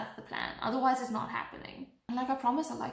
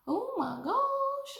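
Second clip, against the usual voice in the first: mock shock; 14 semitones higher, much slower than usual. Shocked by the friend's news.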